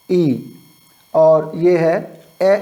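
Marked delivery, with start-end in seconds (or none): 2.4-2.5 ए as a short "eh"; teacher demonstrating short ए; short E sound. Shown as a demonstration. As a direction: say ए brief and open, as in bed. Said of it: "ई की sound short आएगी".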